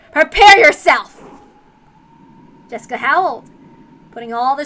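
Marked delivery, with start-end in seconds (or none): 0.1-1.2 loud, slow, menacing warning; menacing threat. Acted. Jessica warns her foe, slow and loud. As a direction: bellow it as a final threat. Threat before final punch.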